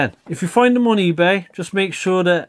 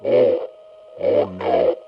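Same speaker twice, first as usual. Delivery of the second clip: much lower, dragging voice changer; slowed tape voice; 13 semitones lower than usual. Shown as a demonstration. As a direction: sound like a chewed-up cassette tape. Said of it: "like someone's chewed up my cassette tape".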